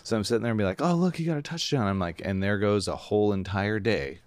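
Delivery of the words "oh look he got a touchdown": high-pitched touchdown reaction; pitched-up mock excitement at usual volume; mock excited sports fan. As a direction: imitate a fan spotting a score, pitched up. Acting out himself watching the game.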